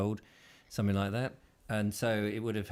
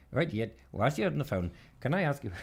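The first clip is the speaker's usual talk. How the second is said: lightly put-on Indian accent; a hint of an Indian call-centre accent; mock Indian accent; 5 semitones higher than usual. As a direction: play a scam caller with an Indian accent. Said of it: "do the same Indian accent on them".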